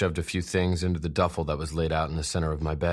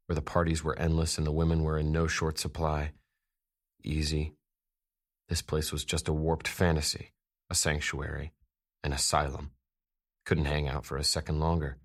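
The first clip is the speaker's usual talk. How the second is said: usual speaking voice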